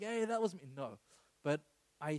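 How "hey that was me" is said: mock boast.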